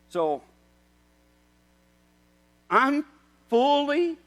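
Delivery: high, loud impassioned preaching; fiery pulpit appeal; preaching at full force. Acted. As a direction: drive each question home. Preaching climax.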